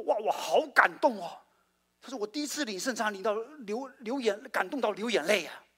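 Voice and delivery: high, emotional gushing quote; hammed-up tearful gushing; mock moved gushing. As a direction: gush like a moved newcomer. Preacher acting moved colleague.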